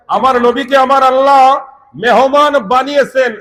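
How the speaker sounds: high, loud sermon declaration; preacher's proclamation. Real, not acted. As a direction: proclaim it to the whole gathering. Preacher proclaims after the verse. (উঁচু গলায় জোরালো ঘোষণা)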